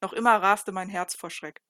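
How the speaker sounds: ordinary delivery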